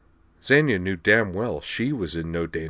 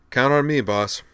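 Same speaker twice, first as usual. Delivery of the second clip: perky salute; 6 semitones higher, 5 dB louder than usual. A narrator's reading. Rena snapping a salute.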